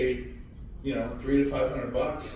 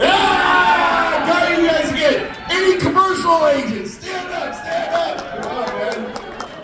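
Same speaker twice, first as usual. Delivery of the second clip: high, loud rallying shout; shouting to the room; 12 dB louder, slower than usual. Real, not acted. Speaker calling out commercial agents in the audience, loud shout. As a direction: yell to rouse the crowd.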